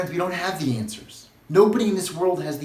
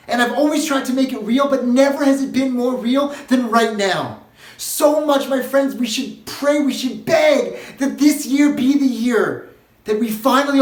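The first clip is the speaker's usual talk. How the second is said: higher, louder, impassioned plea; fervent preaching; 7 semitones higher, 5 dB louder than usual. Real, not acted. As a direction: preach it with rising fervour. Preaching at rising force.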